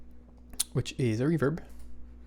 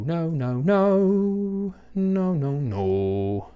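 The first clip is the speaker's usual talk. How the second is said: exasperated no; 6 semitones higher, 5 dB louder, much slower than usual. Real, not acted. Reacting to mistake.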